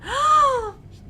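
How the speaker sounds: sharp loud gasp; shocked gasp. Acted.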